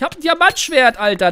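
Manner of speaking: loud proud boasting. Real, not acted. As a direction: brag loudly.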